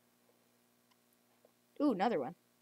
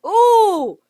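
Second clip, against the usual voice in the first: excited ooh; 9 semitones higher, 12 dB louder than usual. Real, not acted. Spots food in game.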